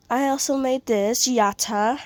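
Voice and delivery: normal talking voice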